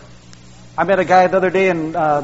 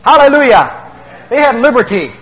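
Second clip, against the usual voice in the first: joyful shout; 5 semitones higher, 6 dB louder than usual. Real, not acted. Preacher rising to a shout.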